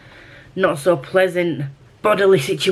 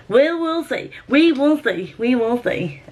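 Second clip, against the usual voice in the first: much higher, swooping "see"; playful singsong; 6 semitones higher than usual. Pretend. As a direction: repeat it in a silly high lilt. Playfully repeats her own phrase.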